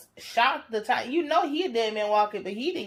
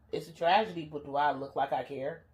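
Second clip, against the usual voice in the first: low, quiet uncaring boss; lightly voiced cold boss; cold mob boss; 6 semitones lower, 5 dB quieter than usual. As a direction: shrug it off like a ruthless gangster. Mob boss brushing off concern.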